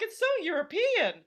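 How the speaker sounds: hammed-up swooning admiration; impressed diner. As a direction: gush as if wildly impressed.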